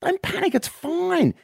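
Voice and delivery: calm him down in a squeaky stranger's voice; squeaky stranger at the bar soothing the drunk; other bloke at the bar. Acted. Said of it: "another bloke comes up and says".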